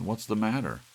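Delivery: high, loud exasperated question; impatient demand. Acted. Larry to Doris, exasperated and impatient. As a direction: demand she explain.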